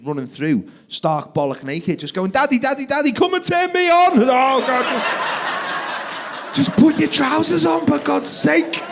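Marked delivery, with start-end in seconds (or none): 2.3-4.2 put-on high, loud child calling out; squealed kiddie demand, pitched right up; shouting little boy. Son running through changing room shouting. 4.2-8.8 high, loud panicked dad; mortified parent's high-pitched outburst; flustered pleading. Acted. Flustered dad begging son.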